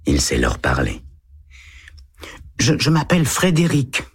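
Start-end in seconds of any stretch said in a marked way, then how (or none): 2.6-4.0 put-on higher, timid little boy's voice; a small boy answering a policeman shyly. A narrator's reading. Eight-year-old boy answers the lieutenant, timid child voice. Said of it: "dit Tommy".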